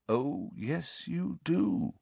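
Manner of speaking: soft, quiet insistence. A narrator's reading. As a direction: let the calm deepen. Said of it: "still more calmly".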